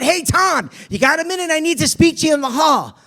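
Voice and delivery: loud, high call across a noisy room; yelling over noise. Retold or imitated. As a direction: shout to be heard over the class. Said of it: "Jeff had to yell over the noise".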